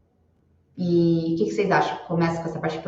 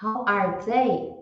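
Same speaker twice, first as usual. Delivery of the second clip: slow, careful how are they; teacher reading How are they slowly; slow model phrase; 3 dB quieter, much slower than usual. Shown as a demonstration. Teacher modeling an English question for students, slowly.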